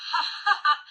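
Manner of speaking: bright burst of laughter; laughing. Real, not acted. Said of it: "Grinning, fascinated".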